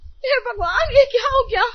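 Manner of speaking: high, loud cry of shock; alarmed shout. Acted. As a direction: shriek it out as the tap breaks off. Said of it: "आप क्यों चिल्ला रही है?".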